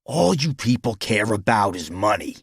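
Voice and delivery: scornful accusation; taunt. A narrator's reading. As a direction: throw it at him as a taunt. Rival taunts the businessman.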